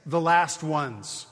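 slow, weighty reading of the Greek; slow literal reading. Shown as a demonstration. Preacher slowly reads the Greek to the congregation, weighty and deliberate. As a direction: lay each word down slowly. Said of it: "The Greek literally reads".

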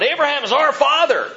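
loud, high defiant proclamation; called out. Acted. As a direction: shout it out like an indignant crowd. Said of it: "they called out".

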